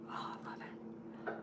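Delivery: soft unvoiced whisper, close to the mic. Real, not acted. Streamer whispering reassurance to a viewer. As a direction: whisper it like a secret.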